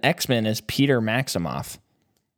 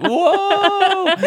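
awed whoa; 5 dB louder than usual. Retold or imitated. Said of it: "You're like".

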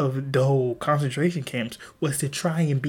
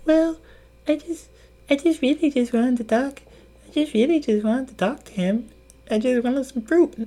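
much higher, meek excuse-making voice; sheepish Eve; 11 semitones higher than usual. Acted. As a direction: play Eve sheepishly justifying herself. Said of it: "She's like".

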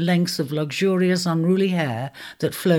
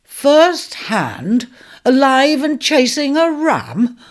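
louder, incredulous centaur, slowing on the last words; booming disbelief at a tall tale; amused doubting centaur; 9 semitones higher, 7 dB louder, slower than usual. Acted.

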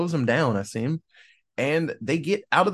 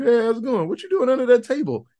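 high, fast, chirpy mock cat greeting; 8 semitones higher, 4 dB louder, faster than usual. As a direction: playfully voice a curious cat, quick and high. Cat voiced in movie recap.

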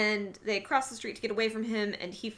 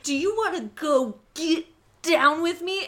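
louder, higher sleazy invitation; mock smooth come-on; 6 semitones higher, 8 dB louder than usual. Boyfriend invites Sarah to hook up, loud and smarmy. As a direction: lay on the moves. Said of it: "he's like".

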